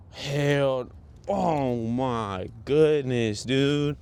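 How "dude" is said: ordinary delivery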